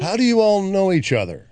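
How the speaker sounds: high-pitched, nosy waitress question; baffled waitress. Acted. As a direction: play a puzzled waitress, voice lifted high.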